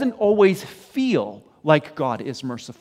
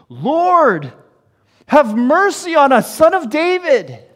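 high, loud pleading cry; 9 semitones higher, 8 dB louder than usual. Acted. Blind men begging Jesus for mercy, crying out. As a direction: call out urgently over the crowd. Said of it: "they cried out all the more".

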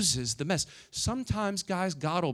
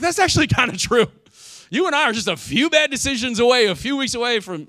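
high, loud burst of agreement; emphatic realization; 9 semitones higher, 11 dB louder than usual. Retold or imitated. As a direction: exclaim it loudly, pitched well up. Reacting to a pastor's sobering warning.

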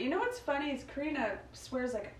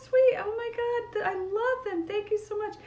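gushing delight; 14 semitones higher, 4 dB louder than usual. Retold or imitated. Grandma receiving flowers from the boy, gushing delight. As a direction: coo over a gift in a high voice.

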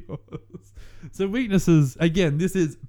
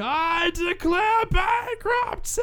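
yelling; much slower than usual. Retold or imitated. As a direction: shout it like Michael Scott. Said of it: "yelling bankruptcy".

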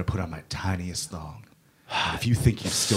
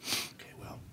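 whisper; 15 dB quieter than usual. Acted. Secret back-room shop deal.

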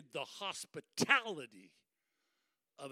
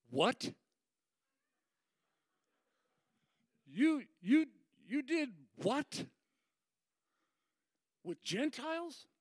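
high, halting, scandalized questions; acted incredulity; 8 semitones higher, 4 dB louder than usual. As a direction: stammer it in mock shock. Believers react to Peter, retold.

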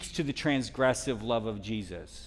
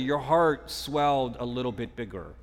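ordinary delivery

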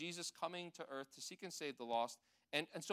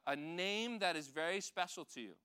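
normal talking voice

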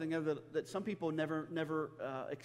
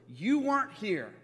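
reproachful accusation; 5 semitones higher, 7 dB louder, slower than usual. Retold or imitated. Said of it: "She says those words".

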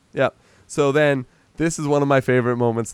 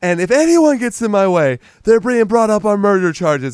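angry threat; 8 semitones higher, 5 dB louder than usual. Retold or imitated. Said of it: "And he's like".

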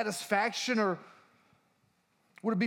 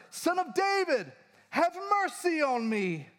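high, pleading cry for mercy; 10 semitones higher than usual. Retold or imitated. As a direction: call out desperately over the crowd. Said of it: "He cried out all the more".

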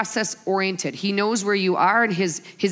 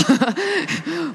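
high, open laugh; real laugh; 9 semitones higher than usual. Real, not acted. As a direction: burst out laughing at yourself. Laughs at her own story.

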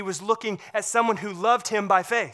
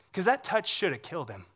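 ordinary talk

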